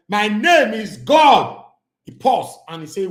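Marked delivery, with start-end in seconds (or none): 0.1-1.6 loud, very slow declaration; mock boast. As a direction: drag out each word like a proclamation. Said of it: "I said to Tokamo".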